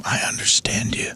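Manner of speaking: intimate, breathy whisper to an idol; hushed, unvoiced confession. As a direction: lean in and whisper it tenderly. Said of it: "and whisper to him".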